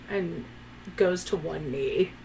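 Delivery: ordinary talk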